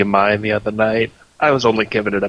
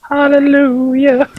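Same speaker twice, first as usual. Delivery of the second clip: mock hallelujah; 13 semitones higher, 4 dB louder, slower than usual. Joining the joke, mock praise.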